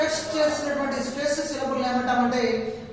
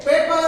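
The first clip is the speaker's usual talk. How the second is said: "paper" with a louder first syllable; accented first syllable; 3 semitones higher, 5 dB louder than usual. Shown as a demonstration. Teacher quizzing on accent, "paper" stressed up front. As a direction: punch the start of "paper". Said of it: "where is the accented syllable?".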